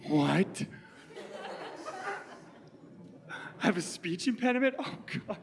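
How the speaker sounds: high, hammed-up breakdown between laughs and gasps; overacted meltdown. Student to drama teacher after hearing of impediment, mock devastated. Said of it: "I gave her acting".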